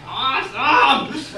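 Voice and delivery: high, drawn-out "awesome"; awed kid excitement. Retold or imitated. Seven-year-old spotting the big water slide, thrilled.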